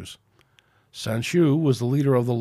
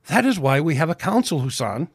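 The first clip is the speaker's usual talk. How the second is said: spouted; 5 semitones higher, 4 dB louder than usual. Acted. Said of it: "spouted Sanshu".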